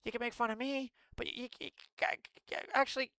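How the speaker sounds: hammed-up simpering complaint; mock whiny defender. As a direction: parody a pleading scold. Mocks defenders of governor.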